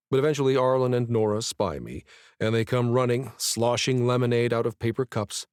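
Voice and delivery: usual speaking voice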